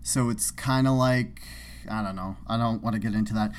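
ordinary delivery

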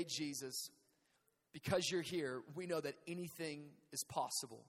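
soft, low pastor's prayer. Acted. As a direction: pray quietly over the congregation. Pastor praying over the room.

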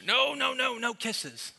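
put-on high, drawn-out little-girl protest; mock toddler wail of refusal. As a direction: imitate a small child refusing, loud and high. Toddler fending off dad's kiss.

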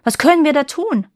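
high, loud, urgent question; exclaimed. A narrator's reading. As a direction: cry out for advice. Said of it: "rief der König".

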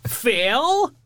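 high, over-the-top salesman voice; Ned Ryerson impression. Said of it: "he ended up with".

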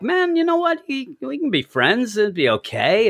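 high, cheerful naive voice; sing-song mock optimism; naively hopeful. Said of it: "it would kinda be like".

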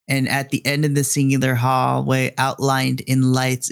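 ordinary delivery

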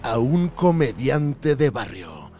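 movie trailer voice. Acted. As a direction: build suspense like a trailer. Continues the trailer-style introduction.

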